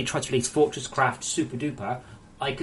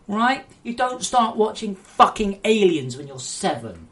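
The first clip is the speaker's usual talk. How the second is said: impassioned loud rant, higher; 4 semitones higher, 5 dB louder than usual. Real, not acted. Streamer ranting to viewers about games being his passion, heated. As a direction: lecture the parents with heat. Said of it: "okay to rant at this".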